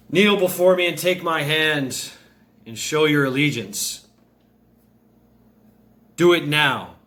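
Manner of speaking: goddess's forceful, high commanding voice. Acted. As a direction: command a subject to kneel before you. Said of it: "in her commanding voice".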